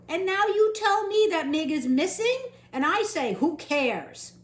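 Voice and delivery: loud, high indignant rant. Acted. Louise ranting at the cook about the dead man. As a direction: rant with scorn.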